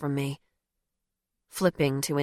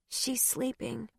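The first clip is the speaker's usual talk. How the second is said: put-on soft, slow, high child voice; a small girl, hushed and slow; quiet little girl; 6 semitones higher, 8 dB quieter, slower than usual. Child answers about her mom.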